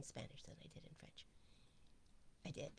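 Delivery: fast whispered boast; whispered aside. Real, not acted. Bragging to the group about Spanish, whispered. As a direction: whisper it like a secret brag.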